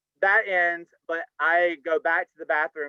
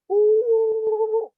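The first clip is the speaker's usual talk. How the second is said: long high held "ooh" as the baggie falls; mock slow-motion ooh; 5 dB louder than usual. Narrating the falling baggie with a held high ooh. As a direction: sing the fall like a movie moment.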